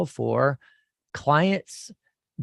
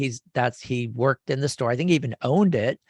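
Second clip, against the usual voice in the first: ordinary talk, nothing marked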